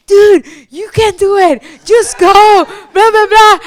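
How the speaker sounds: high, loud, pushy big-sister voice; sister pushing her. Retold or imitated. Sister on the phone urging her crying sibling, loud and high. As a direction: shout the encouragement down the phone.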